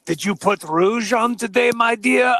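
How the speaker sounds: put-on doctor's questioning French accent. Doctor questioning his daughter about her rouge, French accent. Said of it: "I'm doing my French accent".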